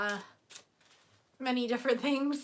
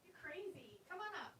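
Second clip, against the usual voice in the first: faint, higher voice from far off; distant pet talk; 4 semitones higher, 20 dB quieter than usual. Real, not acted. Owner coaxing the bulldog up, far-off voice. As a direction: coax the dog from across the room. Talks to dog away from mic.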